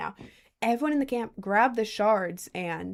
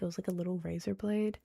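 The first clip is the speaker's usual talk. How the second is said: dropped to a soft, hushed voice; said quietly; 10 dB quieter than usual. Real, not acted. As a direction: keep it quiet so nobody hears. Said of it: "I have to say that quietly".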